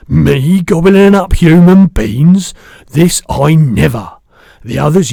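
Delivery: big, slow, booming giant voice; the giant's voice. Acted. Giant correcting the girl, big booming voice.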